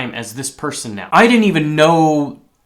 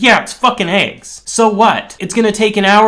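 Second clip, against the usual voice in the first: higher, louder exasperated self-talk; fed-up self-scolding; 6 semitones higher, 5 dB louder than usual. Retold or imitated. Cook scolding himself in the break room, louder and exasperated. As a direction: snap at yourself to get moving.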